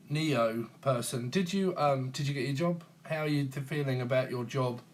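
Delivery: ordinary talk, nothing marked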